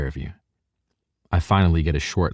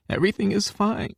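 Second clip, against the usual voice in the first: much higher, fragile insistence; tearful denial. A narrator's reading. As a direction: hold back the sob. Crying girl insists she's fine.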